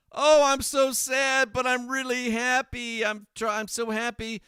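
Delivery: high, loud mocking widow voice; mock her faking grief; mock fake-sad widow. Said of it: "trying to fake to be sad".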